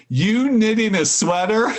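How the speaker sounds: ordinary talk, nothing marked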